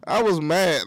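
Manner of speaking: ordinary delivery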